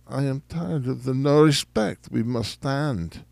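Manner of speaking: ordinary talk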